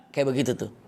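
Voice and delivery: a demo "ahem" to get noticed; throat-clearing ahem. Shown as a demonstration. Preacher shows how the hidden man coughed, deliberate ahem. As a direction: clear your throat on purpose. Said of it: "Bikin suara".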